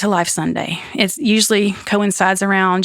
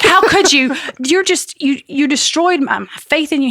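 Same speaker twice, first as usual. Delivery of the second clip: hurt accusation; 7 semitones higher than usual. Retold or imitated. Retold outburst at husband.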